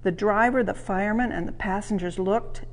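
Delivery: ordinary delivery, nothing marked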